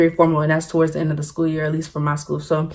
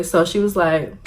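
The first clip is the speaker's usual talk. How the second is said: ordinary talk, nothing marked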